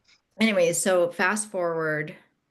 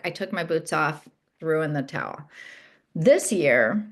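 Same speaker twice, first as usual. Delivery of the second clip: usual speaking voice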